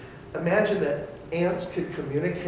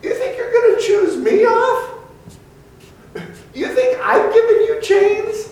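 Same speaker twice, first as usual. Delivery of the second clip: much higher and loud, laughing with scorn; scornful laugh; 13 dB louder than usual. Acted. God scoffing at rebellious people, loud laughing scorn. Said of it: "a laugh of scorn".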